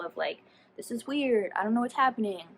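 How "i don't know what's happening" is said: ordinary talk, nothing marked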